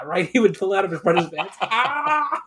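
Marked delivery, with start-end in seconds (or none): 1.6-2.4 high squealed Ah; mock high yelp; high mock yell. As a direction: yelp it like a cartoon wrestler. Imitating Mankind's yell.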